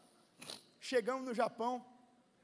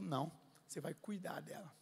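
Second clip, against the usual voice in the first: low, soft voice from God; quiet divine correction; 5 semitones lower, 6 dB quieter than usual. Retold or imitated. God correcting the pastor's plan, quiet and low. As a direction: answer low and softly, with authority. God's answer to his plan.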